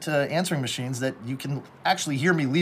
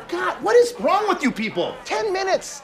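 exasperated; 3 dB louder than usual. Acted. Exasperated host pleads with colleagues.

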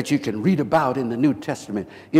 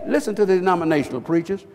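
ordinary delivery

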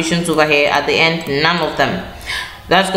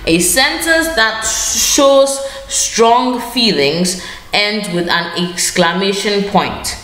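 stretched out, teacherly and deliberate; slow dictation; 3 semitones higher, slower than usual. Shown as a demonstration. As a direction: spell the rule out slowly for the class. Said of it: "teacher states rule slowly, dictating".

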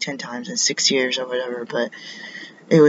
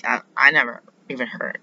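ordinary talk, nothing marked

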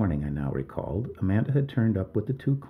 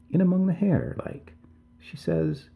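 higher, hesitant, breaking off; faltering; 10 semitones higher than usual. A narrator's reading. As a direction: falter and trail into a pause. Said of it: "Missus King faltered".

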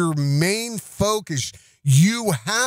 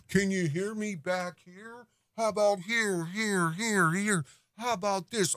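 softer and higher, as if backed away from the mic; off-mic mock; 4 semitones higher, 8 dB quieter than usual. Mocking Owen testing his mic from across the room, distant and soft. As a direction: call from far off the microphone. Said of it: "Mister So Brand New with his microphone".

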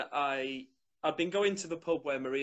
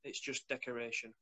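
usual speaking voice

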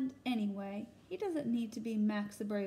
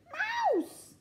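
very high squealing elephant, louder; scared elephant; 3 dB louder than usual. Acted. Elephant frightened by Max, squealing high. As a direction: squeal like an elephant afraid of mice. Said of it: "says Elephant".

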